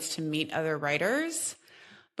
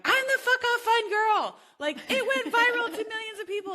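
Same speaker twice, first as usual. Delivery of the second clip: mock bragging voice. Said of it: "I'm like".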